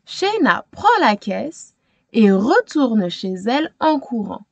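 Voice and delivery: plain reading voice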